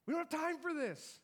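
high, urgent cry of a desperate father; a father at his wits' end, voice jumping up; frantic father. Acted. Jairus voiced, frantic at delay.

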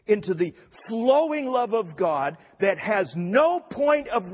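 impassioned preaching. Acted. Preacher telling of Jesus's love for Peter, fervent and insistent. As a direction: build the point with high, emphatic force.

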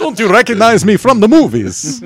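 high, loud, slow character voice; hammy drawn-out impression; Doc Brown. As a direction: declaim it as a crazy old inventor. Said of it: "I'm Doc Brown".

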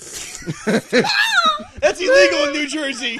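high, slow laugh through the words. Real, not acted. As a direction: laugh it out helplessly. Laughing outburst.

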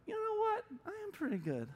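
high-pitched, coy self-congratulation; mock self-satisfied. Believer starting to believe the compliments, mock smug. Acting out our swelling pride.

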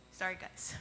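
whispered apology, fighting tears; barely breathing it out while crying; tearful whisper. Real, not acted.

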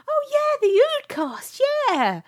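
high, loud imitation of keen fans; excited recognition. Telling how two writers knew the podcast, in excited imitation. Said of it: "when Gareth Roberts and Tony Lee both go".

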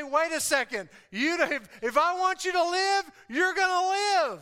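high, loud preacher voicing a command; commanding Jesus. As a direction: preach it as an order to the dead. Voices Jesus commanding the dead.

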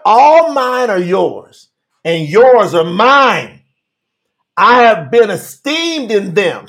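slow, deliberate scripture quote; slow prayerful reading; read real slow. Shown as a demonstration. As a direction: draw the verse out. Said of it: "read this real slow".

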